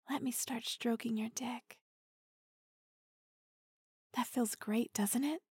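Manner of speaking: intimate teasing whisper; close, breathy-free hushed tease. Acted. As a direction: lean in and whisper it.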